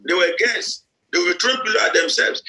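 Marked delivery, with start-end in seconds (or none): none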